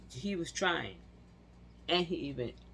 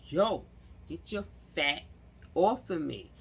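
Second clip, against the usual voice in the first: loud, high yell to get off him; half-yell it, pinned down and hurting; retold scream; 4 semitones higher, 5 dB louder than usual. Pinned under a heavy man, yelling at him to get off. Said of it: "I'm screaming my arm".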